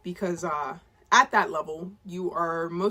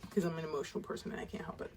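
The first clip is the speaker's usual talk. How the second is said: quick whisper, much softer; whispered, fast; 3 semitones lower, 12 dB quieter, much faster than usual. Real, not acted. Vlogger admitting she gets emotional, fast whisper. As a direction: rush it out under your breath. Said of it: "I'm not gonna get emotional".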